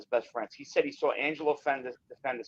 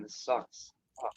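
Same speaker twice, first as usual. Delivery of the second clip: glitchy robot voice from a bad connection; distorted robotic voice over the call; 2 semitones lower, 8 dB quieter, slower than usual. Real, not acted. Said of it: "I got that robot voice again".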